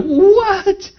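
high-pitched drawn-out disbelief; astonished. Retold or imitated. Husband amazed at wife's speaking in tongues, retold astonished. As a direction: re-enact amazement with a soaring, stretched word. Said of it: "it was a oh my gosh moment".

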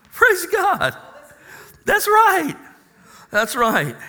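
loud, high, fast preaching; rally the congregation about the new body; full-throated sermon climax; preaching at full force. Acted. Exclaims praise at high pitch.

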